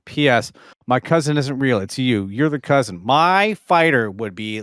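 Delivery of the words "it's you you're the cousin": ordinary talk, nothing marked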